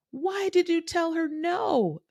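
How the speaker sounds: high, slow shocked protest from the family; family's outcry. Retold or imitated. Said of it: "They were all over me".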